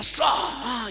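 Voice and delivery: long, soft airy whoosh; imitated sling whoosh. Preacher imagining David's stone flying, imitated whoosh. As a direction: make the stone fly with a drawn-out "sa". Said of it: "Y uno se imagina".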